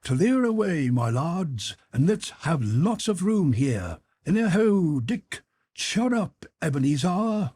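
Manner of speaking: put-on a rich, fat, jovial old master calling out; jovial Fezziwig voice. A narrator's reading. Old Fezziwig calls to his apprentices, jovial and loud.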